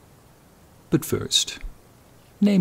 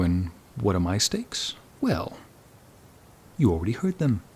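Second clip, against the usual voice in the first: ordinary delivery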